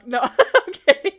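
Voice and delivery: giggling through the retraction; laughing as she takes back the joke. Real, not acted.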